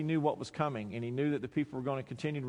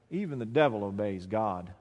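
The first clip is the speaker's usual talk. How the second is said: normal talking voice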